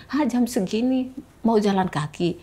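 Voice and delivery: imitating a surprised stranger; mildly acted astonishment at the late-hour walk; retold surprise. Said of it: "turun orang itu".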